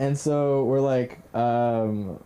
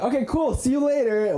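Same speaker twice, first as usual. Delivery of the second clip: imitated high-pitched sign-off; high mock-casual farewell; breezy goodbye; 11 semitones higher, 3 dB louder than usual. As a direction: toss off a much higher goodbye, mimicking her. Imitating girl leaving as he cries.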